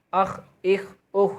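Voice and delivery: اخ with a heavy, full-mouth خ and no echo; اخ said thick and heavy, held shut; full-mouthed kha. Shown as a demonstration. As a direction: fill the mouth on the خ of اخ. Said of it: "منہ بھر کر موٹی آواز سے مستعلیہ کے لفظ نکلتے ہیں".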